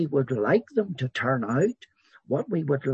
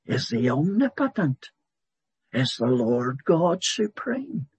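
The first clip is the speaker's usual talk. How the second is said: louder, rising preaching on God's power; full-force preaching; 3 semitones higher, 4 dB louder than usual. Acted. Preacher exalting God's sovereignty to the congregation, louder and rising. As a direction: proclaim each title like a herald.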